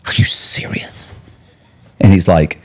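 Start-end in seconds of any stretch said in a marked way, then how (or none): 0.1-1.1 "are you serious" hushed and slow; mock shocked whisper. Inmate fakes shock to Ron, whispering. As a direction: feign disbelief under your breath. Reacting to Ron's re-indictment.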